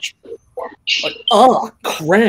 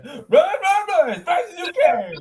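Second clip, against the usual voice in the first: gingerbread man taunt; 13 semitones higher, slower than usual. Acted.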